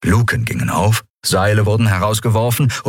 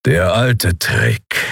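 slow, drawn-out growl; grudging, slowly growled remark; growl of grudging respect; much slower than usual. A narrator's reading. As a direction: growl it with reluctant admiration. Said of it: "knurrte Keru mit widerwilliger Anerkennung".

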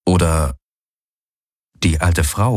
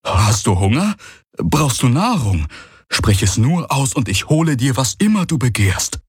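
higher-pitched, eager-to-please youngster; eager young servant; 6 semitones higher than usual. Acted. Young four-arm offering food to the exalted guest, eager. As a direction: offer service with keen devotion.

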